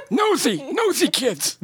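put-on high cranky old villain; old man voice. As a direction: grumble like an unmasked old crook. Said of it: "That's a good old man voice".

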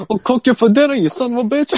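high, hammed-up Bronx threat; Bronx accent. Parodying the dubbed cook threatening someone, exaggerated Bronx accent. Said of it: "this, like, Bronx accent".

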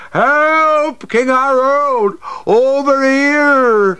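high, loud, slow call for the king; cry for help. Acted. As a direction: yell out across the field. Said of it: "they'll be saying".

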